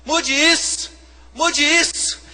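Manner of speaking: high, loud, pleading mock prayer; mock begging prayer. Pastor imitating a believer begging God, high and loud. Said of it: "E você assim".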